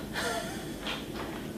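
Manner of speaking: a short high breathy laugh; a quick laugh that pulls the belly in; little laugh. Shown as a demonstration. Therapist demonstrating a little laugh for the belly. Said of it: "Or you can try a little laugh".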